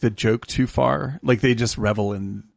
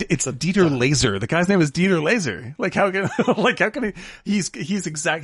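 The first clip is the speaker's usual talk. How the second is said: ordinary delivery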